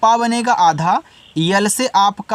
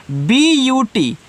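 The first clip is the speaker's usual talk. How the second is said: slow, careful spelling of पी एल ई ए एस ई; letters spelled out one by one, slowly; 3 semitones higher, much slower than usual. Shown as a demonstration. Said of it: "spelling letters".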